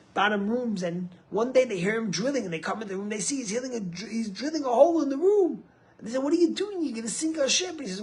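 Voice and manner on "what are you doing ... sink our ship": high, urgent retold outcry; alarmed protest. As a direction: act out an alarmed challenge, voice pitched way up. Shipmates confront man drilling hole.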